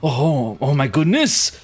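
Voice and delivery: hesitant, rising plea; young man calling out. Acted. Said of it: "young man yelling and saying".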